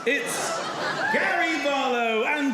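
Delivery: slow, grand compere announcement; compere intro. Acted. Holiday rep introducing the karaoke act, announcer style. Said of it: "Ladies and gentlemen, singing".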